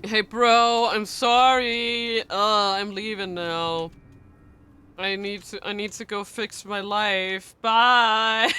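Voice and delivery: loud, drawn-out goofy goodbye; mock farewell. Streamer pretending to leave to fix life, mock farewell. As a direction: drag out each phrase loudly, hammed-up.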